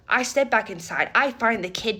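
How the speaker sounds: normal talking voice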